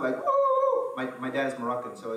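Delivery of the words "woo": high whooping cheer from the hilltop; dad's excited yell. Retold or imitated. Dad cheering his son on the bike, loud high whoop. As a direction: whoop like a proud dad clapping. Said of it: "yelling on the background".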